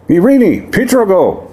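high ringing call across a crowd; like shouted through a glass megaphone; loud clear command. Acted. Warlock commands the demon prince from the crowd, high ringing shout. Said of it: "very loud and clear".

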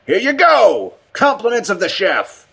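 high-pitched shout in a different voice; loud calling-out. A narrator's reading. Richardson calls out his offering, loud and high. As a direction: call it out loudly like serving up. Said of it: "called out in a louder, different voice".

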